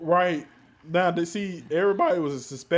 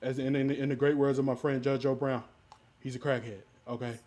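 ordinary talk

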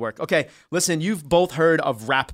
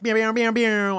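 high voiced air horn blasts; air horn noise; 8 semitones higher, 3 dB louder than usual. Shown as a demonstration. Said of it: "I do the air horn".

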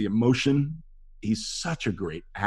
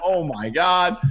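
high, gushing fan outburst; hammed-up awe; starstruck; 10 semitones higher, slower than usual. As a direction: reenact being floored by an idol. Retold starstruck reaction to meeting actor.

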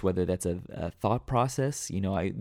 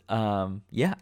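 usual speaking voice

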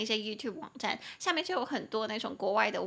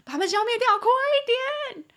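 much higher, louder frantic yell, slow快一点; excited shout; 13 semitones higher, 8 dB louder than usual. Retold or imitated. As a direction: scream at a friend to kill the bugs now. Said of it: "很激动跟他们讲说".

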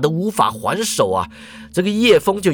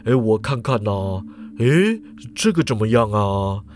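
grumbling professor; 6 semitones lower than usual. A narrator's reading. Professor sulks at the director's scorn, low grumbling. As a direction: sulk and mutter, low. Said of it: "喃喃抱怨地说".

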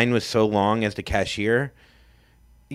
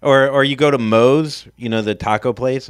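ordinary delivery

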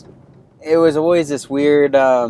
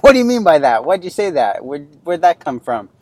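much higher and louder, angry demand; angry questioning; 5 semitones higher, 6 dB louder than usual. Retold or imitated. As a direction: fire the questions at the kid, upset. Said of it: "And so it's like".

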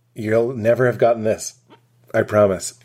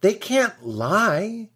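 naive disbelief; 6 semitones higher, slower than usual. Retold or imitated. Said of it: "I was like".

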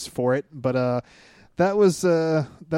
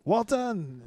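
put-on New England accent; 7 semitones higher, 4 dB quieter than usual. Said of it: "he had the, the New England accent".

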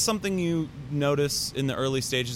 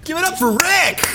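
cheering shout; 6 dB louder than usual. Real, not acted. Cheering for the pilot.

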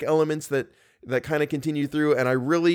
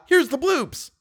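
excited; 10 semitones higher, slower than usual. Real, not acted. Announcing the blooper reel.

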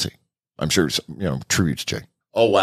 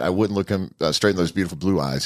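ordinary talk

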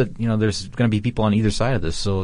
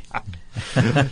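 villain laugh. Acted. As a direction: cackle like a cartoon villain. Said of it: "I fell into a villain laugh there".

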